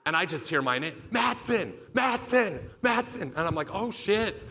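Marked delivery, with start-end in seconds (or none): 1.1-3.3 high-pitched repeated call of a surname; urgent calling out. Retold or imitated. Coach summoning a benched player into the game, urgent high call. 3.7-4.4 mock panic, pitched well up; alarmed reaction. Benched player hears his name called, high alarmed reaction.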